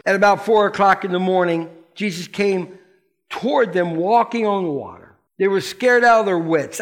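ordinary talk, nothing marked

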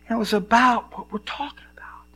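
hushed confiding whisper; whispered aside. Real, not acted. Pastor whispering the Bible study topic to the church. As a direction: let them in on it quietly.

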